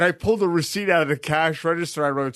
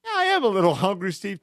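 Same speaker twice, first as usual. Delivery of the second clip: playful banter; 8 semitones higher than usual. Retold or imitated. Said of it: "And he goes".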